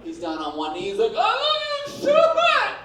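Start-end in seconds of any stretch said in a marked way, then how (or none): 1.2-2.7 much higher, loud, blubbering cry; best friend sobbing a proposal to his girlfriend; hammed-up sobbing proposal. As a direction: wail it like a broken-hearted kid. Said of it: "he starts to cry".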